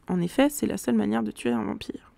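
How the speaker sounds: usual speaking voice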